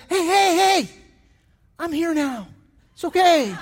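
high, loud swaggering reassurance; cocky paramedic. Retold or imitated. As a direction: play the cocky little medic who has it handled. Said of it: "full of attitude kind of guy".

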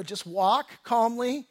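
slow, steady, calming words; calm slow instruction. Retold or imitated. Husband steadying his wife near a mountain lion, slow and calm. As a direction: talk her down slowly. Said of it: "I go".

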